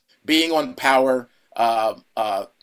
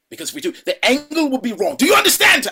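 rising to a high, loud demand; mock stern yell; 12 semitones higher, 5 dB louder than usual. Director instructing the actor before the shot, high loud yell. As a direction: drive the question home like a strict boss. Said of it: "So he's like".

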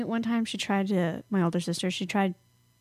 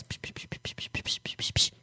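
teasing psh; 9 dB quieter than usual. Pretend.

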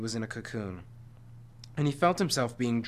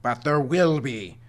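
cursing; 6 dB louder, slower than usual. A narrator's reading. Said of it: "the Watcher cursed".

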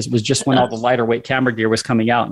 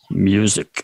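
put-on Russian accent; 5 semitones lower than usual. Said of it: "in a deep Russian accent".

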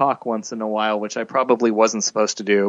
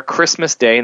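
usual speaking voice

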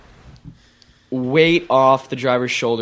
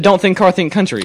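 repeated louder and higher, exasperated; insist, raised voice; louder, insistent repeat; 5 semitones higher, 4 dB louder than usual. Real, not acted. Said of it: "I said".

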